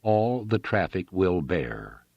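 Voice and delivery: "All the traffic will bear", slow and evenly weighted; each word emphasized. A narrator's reading. Railroad man answering farmer, each word of "All the traffic will bear" emphasized. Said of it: "emphasized each word of his reply".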